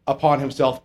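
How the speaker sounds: usual speaking voice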